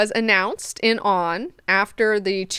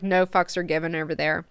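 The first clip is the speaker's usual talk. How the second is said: ordinary talk, nothing marked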